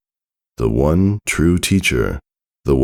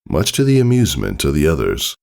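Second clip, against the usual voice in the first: plain reading voice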